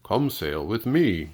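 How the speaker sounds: slow, lightly voiced humpback whale; gentle whale. A narrator's reading. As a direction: invite the snail aboard, slowly. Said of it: "said to the snail".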